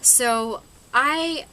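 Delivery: usual speaking voice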